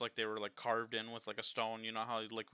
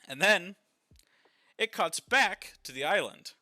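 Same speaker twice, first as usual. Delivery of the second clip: giddy, louder and higher storytelling; bubbling over with excitement; excited; 4 semitones higher, 10 dB louder than usual. Real, not acted. Giddy retelling after shared laugh.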